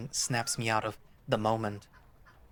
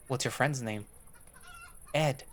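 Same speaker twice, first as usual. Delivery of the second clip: ordinary delivery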